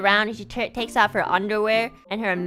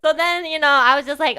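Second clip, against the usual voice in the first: ordinary talk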